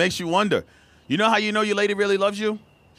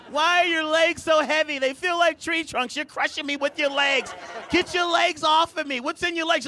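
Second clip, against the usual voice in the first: complaining partner; 8 semitones higher than usual. Retold or imitated. Partner complaining about heavy legs.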